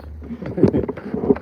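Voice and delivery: breathy voiceless chuckle; short real laugh, loud and breathy. Real, not acted. Laughs at reading comments.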